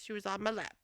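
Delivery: ordinary delivery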